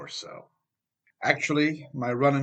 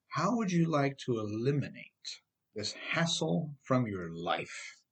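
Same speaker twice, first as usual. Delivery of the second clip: ordinary delivery, nothing marked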